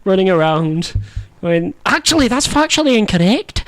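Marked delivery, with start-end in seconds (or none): 1.9-3.6 high, mock child's voice; squeaky imitation of a pedantic kid; pitched-up teasing impression; imitating wee Simon. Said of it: "you sound like the".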